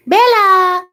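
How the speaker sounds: loud, high call across the house; calling the dog. Real, not acted. Owner calling her dog from across the room, loud and high. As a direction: shout her name to fetch her.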